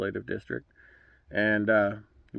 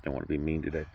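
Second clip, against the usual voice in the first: emotional, trailing into near silence; choked up; 5 semitones lower, 8 dB quieter, faster than usual. Real, not acted. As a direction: voice dropping low and soft, holding back tears. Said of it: "sorry if I'm a bit emotional about this".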